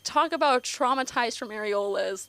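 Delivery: ordinary talk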